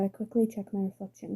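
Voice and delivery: ordinary delivery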